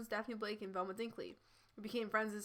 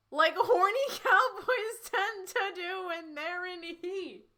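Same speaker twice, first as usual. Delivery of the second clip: high-pitched, slow, helpless laughter; laughing while reading; 9 semitones higher, 7 dB louder, slower than usual. Real, not acted. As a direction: struggle to read through the laughter. Reader cracks up at the fic.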